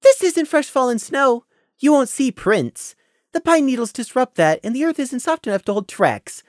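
high, sugary mock explanation; sweetly mocking. Prey sweetly mocking Scenic about badger tracks. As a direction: tease him sweetly like a child. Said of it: "sweetly mocking the earth pony".

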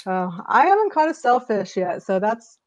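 ordinary talk, nothing marked

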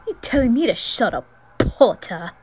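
loud, scornful retort; Malfoy's scornful challenge at Potter; sneering challenge. Acted. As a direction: throw it back at him. Malfoy answers the quarrel.

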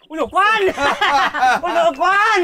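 high exclamation breaking into laughter; delighted greeting. Acted. Host recognising the visiting mandarin, delighted greeting.

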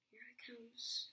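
whisper. Acted. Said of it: "make silly sounds like".

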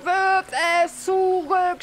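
high, drawn-out mock shout; retold cry. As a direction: act out his desperate cry, stretched out. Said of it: "schrie er wieder und wieder".

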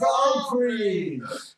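put-on slow, loud Chinese accent shout. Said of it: "with a Chinese accent".

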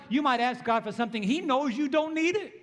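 ordinary talk

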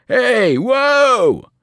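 high, loud, climbing shout; driver's panicked yell. A narrator's reading. Bus driver losing control of the wheel, loud panicked shout. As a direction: yell as the wheel gets wrenched away. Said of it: "the driver yelled".

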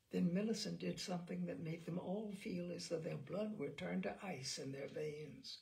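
plain reading voice

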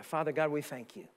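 slow, soft pastoral prayer; closing prayer. Real, not acted. Pastor praying over the standing congregation, slow and hushed. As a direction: pray over the congregation slowly and gently.

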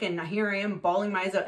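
usual speaking voice

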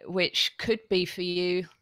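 ordinary delivery

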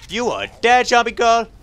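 loud, high taunt; combat taunt. Real, not acted. Player to a zombie girl in a fight, loud taunting. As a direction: shout it up high at the enemy.

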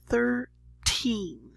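THIRTEEN with a long, stressed second syllable, slowly; stress on last syllable. Shown as a demonstration. Teacher shows learners how to say the teen numbers, stressing the last syllable of THIRTEEN. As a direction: draw out and stress the end of THIRTEEN. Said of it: "So stress the last syllable".

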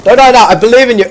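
high, loud encouragement; urgent pleading. Real, not acted. Gamer urging a teammate to stay alive, urgent pleading.

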